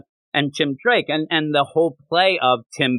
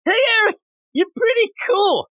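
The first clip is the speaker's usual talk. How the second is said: calling out. Retold or imitated. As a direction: yell it over to the next tent. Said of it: "I'm yelling over to you".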